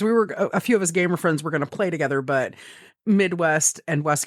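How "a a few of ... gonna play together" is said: ordinary delivery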